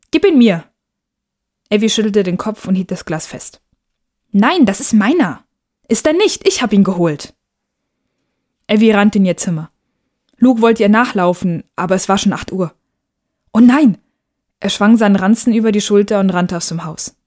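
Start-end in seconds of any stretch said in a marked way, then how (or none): 0.1-0.6 higher, louder sharp command; angry demand. Acted. Brother demands the butterfly. 4.3-7.4 cling to it, protesting like a little sister; defiant child. Acted. Sister refuses to give it back. 13.5-14.0 much louder, higher cry of dismay; boy realising he is late for school; alarmed. Acted.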